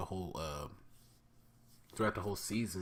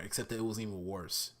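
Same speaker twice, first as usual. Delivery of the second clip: normal talking voice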